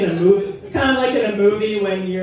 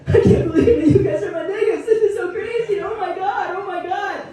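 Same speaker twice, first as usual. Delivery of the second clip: much higher, loud, laughing overexcited guy; 4 dB louder than usual. Retold or imitated. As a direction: squeal it like the best night of his life.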